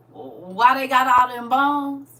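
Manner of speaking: ordinary delivery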